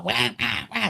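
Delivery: mock Washington twang as high wah-wah noises; high, mocking Washington-accent gibberish. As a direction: imitate an American from Washington with wordless wahs. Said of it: "from Washington".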